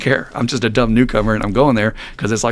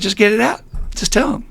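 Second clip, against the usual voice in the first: high-pitched imitation of an older man; imitated coaxing, short pushes; old timer urging; 11 semitones higher than usual. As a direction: egg him on in a put-on high voice. Old timer urging him, retold.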